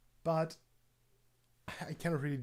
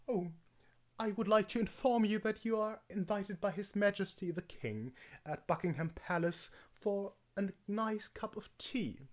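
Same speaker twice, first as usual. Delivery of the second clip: hammed-up upper-class English accent; posh English accent; 4 semitones higher than usual. Shown as a demonstration. Inviting someone to tea with the King, posh English accent. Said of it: "Or the other way is just".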